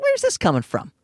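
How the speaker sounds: high mock bewilderment; high-pitched questioning. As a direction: ask it way up high, taken aback. Acted question to departing friend, first take.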